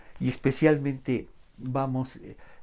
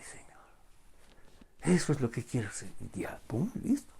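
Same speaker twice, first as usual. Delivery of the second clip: whisper; 3 semitones lower, 9 dB quieter than usual. Acted. Whispered aside mocking the greedy wish.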